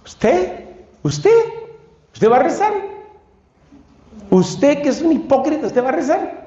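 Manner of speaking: voz más aguda, acusadora y burlona; demonio acusador burlón. Acted. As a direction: interroga con desprecio, como el diablo que acusa. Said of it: "trata de desanimarnos en la oración acusándonos". (el demonio se burla del que quiere rezar, voz aguda y acusadora)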